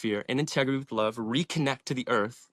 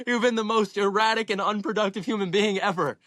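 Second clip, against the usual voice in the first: mocking mom; 9 semitones higher than usual. Mom scolding him, retold.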